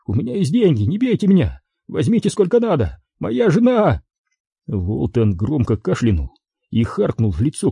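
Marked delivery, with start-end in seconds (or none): 0.1-4.0 high-pitched acted begging; desperate pleading. A narrator's reading. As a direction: plead with a captor, voice raised in pitch. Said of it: "взмолился Кеннинг". (отчаянная мольба, высоким голосом)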